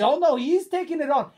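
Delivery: high, loud excited commentator; hammed-up commentator impression; Alan McManus. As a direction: call it out in mounting disbelief. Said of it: "and then he says".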